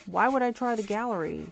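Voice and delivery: loud, indignant question; exasperated retort. Real, not acted. Gamer answers a suggestion to try the gallery, loud exasperated retort. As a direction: throw the suggestion back loudly. Snaps back at a viewer's suggestion.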